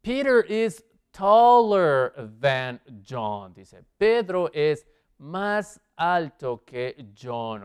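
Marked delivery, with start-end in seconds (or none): 0.0-3.5 slowed right down, each word laid out for the class; slow dictation pace. Shown as a demonstration. Teacher to Spanish-speaking students, reading the comparative slowly. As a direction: read it slowly like a model answer for learners. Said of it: "teacher models the answer sentence".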